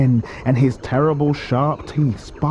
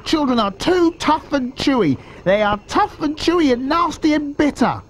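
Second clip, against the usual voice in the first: high-pitched little-croc voice; high, insistent character voice; not-so-big crocodile, disapproving. A narrator's reading. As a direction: protest way up high, as a small croc. Smaller crocodile in story.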